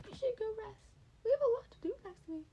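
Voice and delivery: decide it for him briskly; brisk high-voiced order; determined Nezu. A narrator's reading. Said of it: "said determinedly with a small nod".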